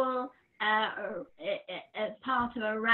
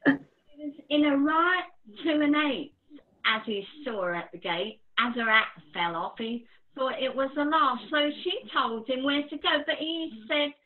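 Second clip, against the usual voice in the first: poem recital; 4 semitones higher, 6 dB louder than usual. Acted. Reciting her pub poem.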